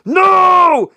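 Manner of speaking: high, loud desperate shout; yelling. Acted. Batman yells to the doctor, loud and high. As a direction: cry out to stop her. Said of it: "Batman yells".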